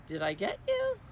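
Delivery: very high playful tease; teasing. Acted. Miss Julie teasing the kids she tricked, high and playful. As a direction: catch them out gleefully.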